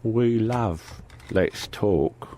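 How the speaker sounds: very slow, soft mock English voice; put-on English accent. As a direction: draw it out in the silly accent. The same mock voice repeated.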